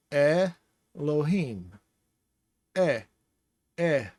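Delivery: Elohim slowly, starting with eh from the back of the throat; throaty eh. Shown as a demonstration. Teacher demonstrates the guttural Aleph to viewers, throaty eh. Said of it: "You say eh with the back of your throat".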